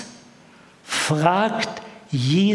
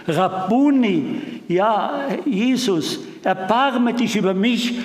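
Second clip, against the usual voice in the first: slow, higher pleading cry; a blind man calling out for mercy; narrated cry; 6 semitones higher than usual. A narrator's reading. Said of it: "Der Blinde schreit". (ein höheres, flehendes Rufen)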